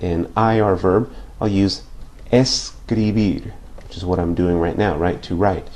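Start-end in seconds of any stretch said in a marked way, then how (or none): 2.3-3.5 slow model word. Shown as a demonstration. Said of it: "slow model of verb".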